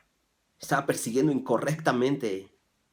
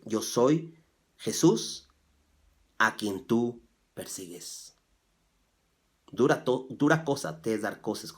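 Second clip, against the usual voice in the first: slow solemn voice; 4 dB louder than usual. Acted. Jesus answering Saul.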